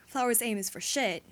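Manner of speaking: ordinary delivery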